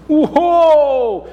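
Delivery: high, loud amazed whoa; king's amazed whoa. Acted. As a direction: gasp at the idea of being God. King reacts to offer.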